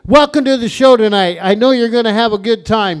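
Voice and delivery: usual speaking voice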